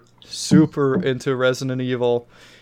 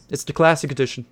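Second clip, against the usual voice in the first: ordinary delivery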